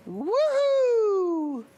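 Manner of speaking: high, loud cheer of delight; celebratory whoop; excited whoop. Real, not acted. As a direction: let out a big happy woohoo. Celebrating end of show.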